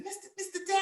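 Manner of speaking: put-on small high girl's voice; timid child calling teacher; quiet student. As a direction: lightly voice a shy little girl. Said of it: "She said".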